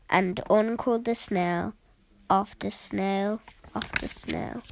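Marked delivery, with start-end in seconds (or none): 3.7-4.6 softer, breathy fade-out whisper; fading whisper. A narrator's reading. Narrator ending the snail line, fading whisper. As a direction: let it die away to a whisper.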